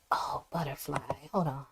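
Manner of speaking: breathy whispered aside to herself; a soft whispered oh for a viewer. Real, not acted. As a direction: drop to a hushed whisper. Host reacting softly while reading chat.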